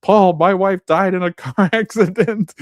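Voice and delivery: high, loud panicked-passenger yell with a laugh breaking in; retold yell. Passenger yells at the reckless driver, laughing mock yell. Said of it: "Jimmy yells from the passenger seat".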